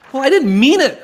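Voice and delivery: hammed-up sheepish excuse; mock excuse. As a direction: wriggle out of it in a squeal. Acted quarrel with how types.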